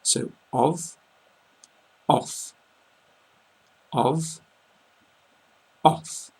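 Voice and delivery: alternating of with v and off with long f; of off drill. Shown as a demonstration. Teacher drilling the pair for learners, carefully. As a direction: drill the pair back and forth. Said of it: "you can really hear the double F there".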